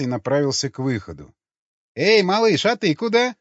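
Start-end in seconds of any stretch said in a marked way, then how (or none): 2.0-3.3 high bright call after him; exclaiming. A narrator's reading. Curly brunette calling after Nikolai as he leaves. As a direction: call out playfully to the boy leaving. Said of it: "воскликнула четвёртая".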